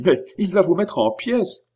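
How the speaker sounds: high, urgent voice of alarm; alarmed warning. A narrator's reading. Oliva warns the unconcerned stranger, alarmed. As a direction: warn him as if danger is at the door.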